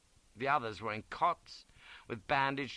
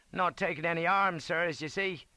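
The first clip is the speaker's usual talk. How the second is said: high, loud soldier's voice; respectful sergeant; 8 semitones higher, 5 dB louder than usual. A narrator's reading. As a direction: report cheerily to a superior. Sergeant talks to officer.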